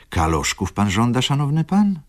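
sugary, rising salesman's question; butter up the customer; lightly fawning clerk. Acted. Clerk greets customer with light fawning sweetness.

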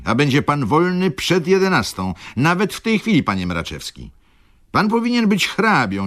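high, sharp sarcastic outburst; bursting out. Acted. Old clerk bursts out at Mraczewski, high and sarcastic. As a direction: explode at the lazy clerk. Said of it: "wybuchnął Rzecki".

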